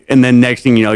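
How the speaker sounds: ordinary talk, nothing marked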